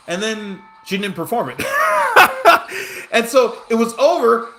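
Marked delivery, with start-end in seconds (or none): 1.7-3.1 loud, high-pitched cackling laugh; burst of laughter. Real, not acted. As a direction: crack up loudly at your own story. Laughing at his own story.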